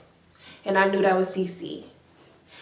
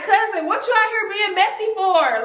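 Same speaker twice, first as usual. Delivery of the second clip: mock scolding; 9 semitones higher than usual. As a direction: call her out for being messy, high. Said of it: "I wanted to be like".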